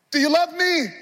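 high, loud cry of the question; loud pleading. Acted. Jesus pleading with Peter at the peak, loud question. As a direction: cry it out to the whole room.

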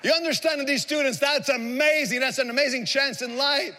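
high, ringing sermon delivery; impassioned preaching. Real, not acted. Preacher declaring that only God erases debt, impassioned. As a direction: proclaim it with mounting conviction. Preaches to congregation at full force.

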